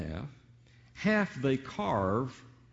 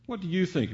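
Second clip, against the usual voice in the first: "you" lifted in a quicker, higher question; stress on YOU; 7 semitones higher, faster than usual. Shown as a demonstration. Preacher repeats the question to the congregation, stressing "you". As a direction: lean on the word "you" in a high-pitched question. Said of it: "change the emphasis here".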